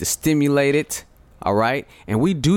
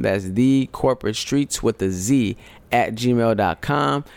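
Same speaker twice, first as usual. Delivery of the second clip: usual speaking voice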